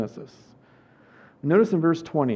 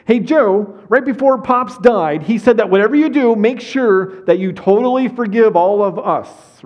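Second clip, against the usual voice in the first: higher, louder, breezy wheedling tone; mock pleading brothers; 5 semitones higher, 5 dB louder than usual. Brothers sweet-talking Joseph with a fake message, hammed-up wheedling.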